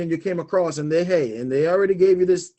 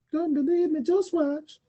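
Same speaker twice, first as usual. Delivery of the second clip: high mock repeat; 12 semitones higher, 4 dB quieter than usual.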